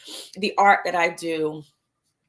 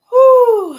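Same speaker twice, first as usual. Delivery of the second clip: long exhale; 13 dB louder than usual. Shown as a demonstration. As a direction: breathe out slowly, then a loud laughing whew. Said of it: "let's just take a moment to just have a nice inhale".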